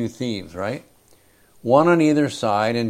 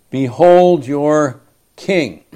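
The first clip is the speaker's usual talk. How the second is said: slow, louder mock proclamation of the king; slow proclaimed sarcasm; 4 semitones higher, 7 dB louder, slower than usual. Pilate mocking the crowd, slow sarcastic proclamation. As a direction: Pilate sneering as he presents him. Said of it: "Pilate has to be speaking sarcastically".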